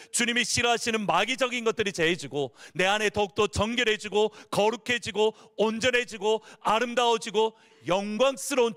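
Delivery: rising, sing-song sermon voice; fervent preaching. Acted. Pastor exhorting the congregation, fervent and rising. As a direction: lift the congregation with every phrase.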